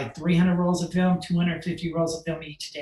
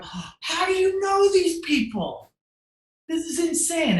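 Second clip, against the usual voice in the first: astonished shout; 12 semitones higher, 4 dB louder than usual. Retold or imitated. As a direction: gasp it out like you can't believe your eyes. Clients stunned by celebrity photos.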